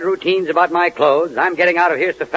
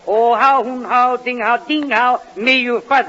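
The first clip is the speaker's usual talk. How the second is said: mock Chinese reply; 5 semitones higher, slower than usual. Answers phone in fake Chinese.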